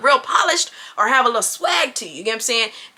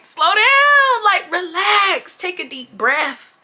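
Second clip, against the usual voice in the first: urgent calming plea; 6 semitones higher, much slower than usual. Retold or imitated. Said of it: "Looking at him like".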